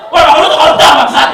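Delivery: mock yell. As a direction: shout the insult at a friend. Friend insults kid's corrupt father.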